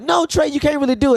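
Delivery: lightly voiced high mock of his wife; high, doubtful mock of Shay; mock doubting voice. Imitating his wife telling him he can't fly, mock high voice.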